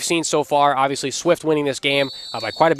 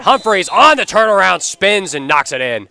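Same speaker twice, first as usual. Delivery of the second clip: excited play-by-play; 5 semitones higher, 9 dB louder than usual. Real, not acted. Live goal call.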